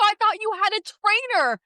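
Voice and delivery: mock-furious at the husband; exasperated. Retold argument after the stab.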